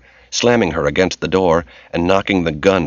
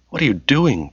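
higher, mild tearful question; through tears; 7 semitones higher than usual. A narrator's reading. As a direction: ask it in a lightly tearful voice. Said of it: "through anguished tears".

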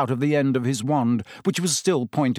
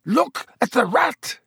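high, strained exclamation; desperate croak; 11 semitones higher, 4 dB louder than usual. A narrator's reading. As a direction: beg them to look, voice cracking with urgency. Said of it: "he croaked".

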